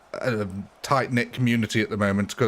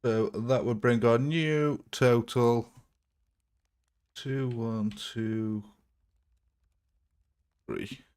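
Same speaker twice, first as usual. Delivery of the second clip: slow count, fading softer on each number; dramatic countdown; 4 dB quieter, much slower than usual. Acted. Streamer building suspense over the new kill total, slow and fading. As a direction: draw out the reveal, dropping away to almost nothing.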